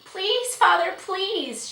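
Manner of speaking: high, drawn-out pleading; begging. A narrator's reading. Girl begging her father, pleading. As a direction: implore him, stretching the last please. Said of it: "she begged".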